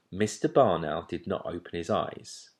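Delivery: ordinary delivery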